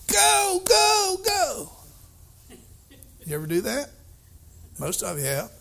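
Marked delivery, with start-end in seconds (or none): 0.1-1.7 sports parent shouting go; cheering shout. Retold or imitated. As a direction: yell your kid on to the finish.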